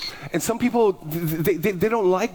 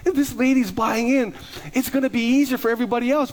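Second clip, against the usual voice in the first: excited; 8 semitones higher than usual. Real, not acted. Excited about lady buying in.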